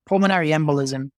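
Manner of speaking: ordinary talk, nothing marked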